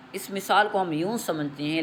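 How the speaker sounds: usual speaking voice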